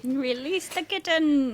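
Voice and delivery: slow, high drawn-out chant; mock dramatic command. Co-host jokingly demanding the cat be freed, mock dramatic. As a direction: stretch it out, playfully grand.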